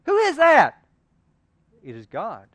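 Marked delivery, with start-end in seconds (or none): 0.1-0.8 loud, high shouted question; man on the limb shouts up at the voice; startled yell. Retold or imitated. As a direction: call up to the sky in alarm. Man on the cliff yells up.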